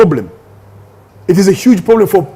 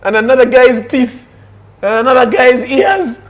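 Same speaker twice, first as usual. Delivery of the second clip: higher-pitched, mounting disbelief; mock indignation; 6 semitones higher than usual. Acting out a duped father's discovery.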